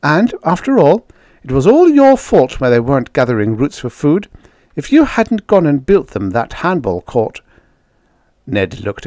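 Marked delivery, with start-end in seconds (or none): 0.0-7.4 raised, louder accusing retort; defiant. A narrator's reading. Judy blames the men for the hungry natives, defiant. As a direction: throw the blame back indignantly. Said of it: "Judy replied defiantly".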